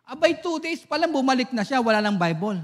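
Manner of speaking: ordinary delivery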